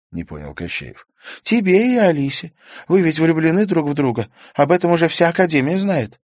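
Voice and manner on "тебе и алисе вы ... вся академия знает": put-on higher young girl's voice, rueful; girl Karina voiced. A narrator's reading. Karina admits her foolishness to Stas, higher girl's voice. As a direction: play Karina, higher and lighter, sheepish.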